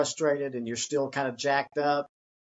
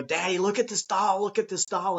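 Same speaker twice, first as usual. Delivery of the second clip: put-on excited child; 5 semitones higher than usual. Child excited about new toy.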